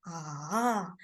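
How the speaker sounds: long "ah" dipping and rising; drawn-out "ah", down then back up; third tone. Shown as a demonstration. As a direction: scoop "ah" down low and up again. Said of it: "it's falling down and rising up".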